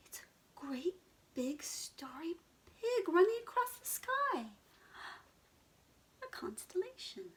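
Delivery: high breathless whisper of wonder; excited whisper. Acted. Henry the pig showing the night sky, high excited whisper. Pig finds star picture.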